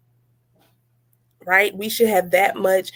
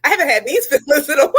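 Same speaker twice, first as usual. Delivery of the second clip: higher, fast, laughing through it; laughing echo; 6 semitones higher, 3 dB louder, faster than usual. Retold or imitated. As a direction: rattle it off, giggling. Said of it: "echoes the quote".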